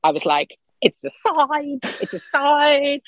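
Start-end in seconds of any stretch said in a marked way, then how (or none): 0.8-1.8 high, awed cry of revelation; excited exclaim. Retold or imitated. Tree falls, declares omen. 2.0-3.0 high, awed repeat of the omen; excited exclaim. Retold or imitated.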